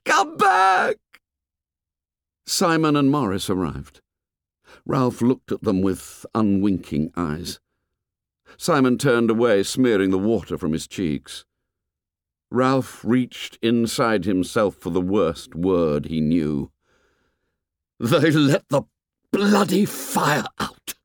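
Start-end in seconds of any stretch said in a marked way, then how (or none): 0.0-1.0 frantic, very high-pitched scream; insanely rising scream. A narrator's reading. Boy running along the cliff after the ship, frantic scream. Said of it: "his voice rose insanely". 18.0-21.0 high, outraged swearing with drawn-out words; bitter cursing. A narrator's reading. Boy finds the signal fire dead, bitter angry outburst.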